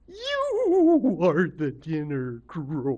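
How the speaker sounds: slow, loud, gliding creature voice; octopus lady. A narrator's reading. Octopus Lady reveals Crow is dinner, in a drawn-out swooping voice. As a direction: draw out the first word very long. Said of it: "And Octopus Lady says".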